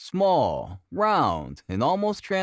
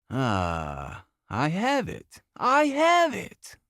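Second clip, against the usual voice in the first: delighted cry; 3 semitones higher, slower than usual. A narrator's reading. Holmes seizing the answer, shrieking with delight. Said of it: "With a perfect shriek of delight".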